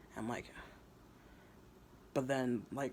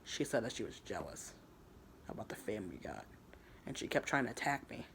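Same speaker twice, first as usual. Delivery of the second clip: ordinary delivery, nothing marked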